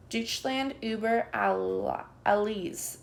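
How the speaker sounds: plain reading voice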